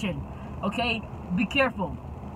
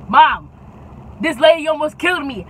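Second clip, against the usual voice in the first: urgent; 4 semitones higher, 6 dB louder than usual. Retold or imitated. Calling mom upset.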